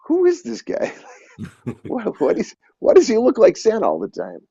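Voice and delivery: high-pitched mock bewilderment; baffled onlooker. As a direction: imitate puzzled locals gawking at a stranger. Voices puzzled locals staring at him.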